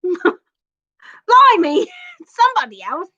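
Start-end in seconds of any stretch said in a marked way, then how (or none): 0.0-1.9 laughing high "Blimey"; giggly British exclamation; British accent. Acted. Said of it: "British accent".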